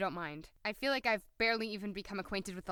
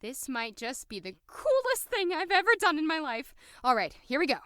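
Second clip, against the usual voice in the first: excited; 6 semitones higher, 5 dB louder than usual. Acted. Excited heist moment.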